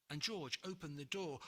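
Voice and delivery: ordinary delivery, nothing marked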